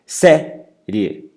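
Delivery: série split, SÉ high and loud; stress on SÉ. Shown as a demonstration. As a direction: punch SÉ in série, then let the rest drop. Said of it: "mais forte o sé".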